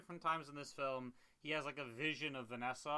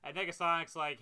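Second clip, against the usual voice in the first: normal talking voice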